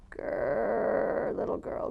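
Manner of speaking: a growl on grrr, then a rasp; growling ogre. Acted. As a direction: growl it like a beast. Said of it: "growled the ogre".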